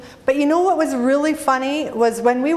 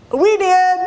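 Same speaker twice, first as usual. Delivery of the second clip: loud confession; 7 semitones higher, 5 dB louder than usual. Real, not acted. Admits buying the clamp.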